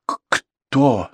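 stunned, struggling to get it out; forced out with difficulty. A narrator's reading. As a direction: squeeze the word out haltingly. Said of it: "с трудом выдавил из себя".

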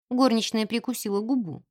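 usual speaking voice

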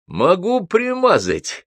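high, drawn-out eager voice; jabbering drunk. A narrator's reading. Гнедич butts in with a betting offer, jabbering. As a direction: pipe up eagerly with a scheme. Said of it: "затараторил Гнедич".

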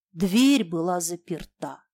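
ordinary delivery

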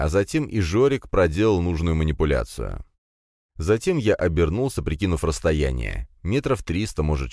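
ordinary delivery, nothing marked

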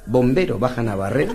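ordinary talk

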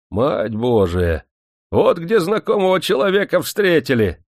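ordinary delivery, nothing marked

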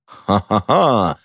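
slow, bitter stage laugh; drawn-out, hollow "ha-ha-ha"; pained laugh. A narrator's reading. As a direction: laugh painfully, syllable by syllable. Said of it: "болезненно рассмеялся граф".

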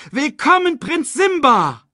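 high cheer, stretched on the name; jubilant shout. A narrator's reading. Crowd hails Prinz Simba, jubilant shout. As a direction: hail the prince at a high pitch. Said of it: "riefen sie".

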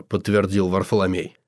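usual speaking voice

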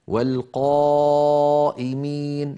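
"والقائمين" slow, with a dark, backed long aa; heavy alif. Shown as a demonstration. Teacher demonstrates heavy alif after qaaf, drawn out. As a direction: thicken and stretch "قا" in "والقائمين". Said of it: "الألف فخمت لأنها سبقت بقاف".

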